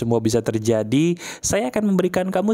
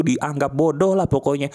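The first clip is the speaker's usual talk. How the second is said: ordinary delivery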